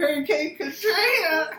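words through giggles; laughing while you say it. Real, not acted. Cracks up at own card.

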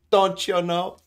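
hammed-up Fargo Minnesota drawl on "Don't you know?", with a laugh; Minnesota accent. Shown as a demonstration. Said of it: "kinda like a Canadian Fargo".

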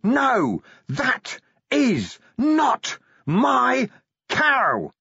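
high, drawn-out anguished scream. A narrator's reading. Creature on its knees beseeching the gods, screaming. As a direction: scream each word slowly to the heavens. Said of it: "and screamed".

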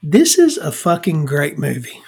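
ordinary talk

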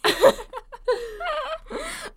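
high, loud cackling laugh; burst of laughter. Real, not acted. Friend tickled by "so mid", high laughing fit. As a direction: crack up completely.

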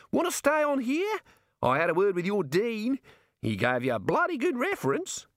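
high-pitched Australian character voice; friendly Australian Bill, persuading. Acted. Bill offers Rincewind a place, high Australian voice.

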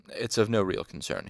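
usual speaking voice

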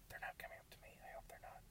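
hushed nervous whisper. Real, not acted. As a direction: whisper so the passing drivers won't hear. Nervous as tractors approach.

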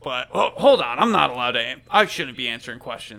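loud, hesitant guard protesting; flustered guard. Acted. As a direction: stammer it out as a guard caught off duty.

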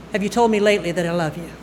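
plain reading voice

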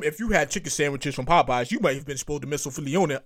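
low, fast mock legal-ad announcer; lawsuit ad voice. Host imagining a future lawsuit ad, low mock announcer. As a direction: parody a TV lawyer commercial. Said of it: "they don't be on some".

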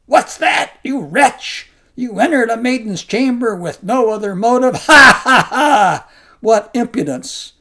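loud mocking fury with a booming laugh; snarling scorn. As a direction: scoff and roar at the monk. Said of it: "So snarling".

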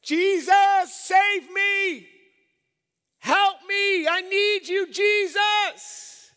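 desperate high-pitched shout for rescue; full-force yelled plea; yelling out. Acted. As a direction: cry out to Jesus at full volume. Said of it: "you just yelled out".

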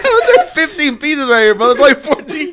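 high, laughing mock-puzzled quote; spluttering with laughter through the quote; laughing retelling.

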